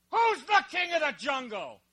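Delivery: high, put-on bully lion voice; preacher's joke lion challenging the monkey; bossy lion. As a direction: demand it like a swaggering lion.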